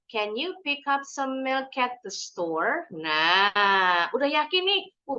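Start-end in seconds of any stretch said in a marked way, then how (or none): 0.1-2.9 slow, linked words, rising on "store"; slow practice reading. Shown as a demonstration. Teacher models home practice for beginners, slow and connected. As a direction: take it slowly, joining "milk at" without a break. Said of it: "awalnya pelan-pelan dulu".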